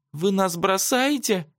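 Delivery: put-on high, slow girl's shriek; high-pitched panicky question; squealing. A narrator's reading. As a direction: squeal in dismay at being left. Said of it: "взвизгнула Лиза".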